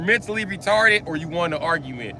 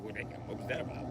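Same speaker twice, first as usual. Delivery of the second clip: put-on confused girlfriend; 10 semitones lower, 20 dB quieter, faster than usual. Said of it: "She's gonna be like".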